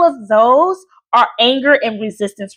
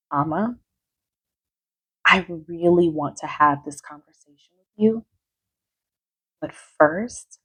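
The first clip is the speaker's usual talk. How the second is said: calm, soft reply; 5 semitones lower, 8 dB quieter than usual. Shown as a demonstration. Coach shows how to answer a yelling mother calmly. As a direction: stay gentle and steady, never rising. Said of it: "watch my face, watch my tone".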